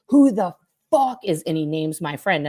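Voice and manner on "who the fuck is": louder, higher, slow hostile demand; imitating the boss snapping at her, loud and slow; angry demand. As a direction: interrogate her like a suspect. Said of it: "he's like".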